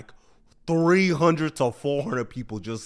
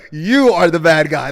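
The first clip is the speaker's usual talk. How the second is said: laughing verdict; 3 semitones higher, 12 dB louder than usual. Real, not acted.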